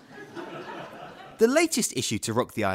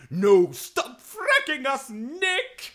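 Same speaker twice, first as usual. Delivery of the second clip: high, slow whiny pleading voice; mocking victims' cry; 13 semitones higher, slower than usual. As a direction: mimic the pitiful victims. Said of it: "They'll be crying".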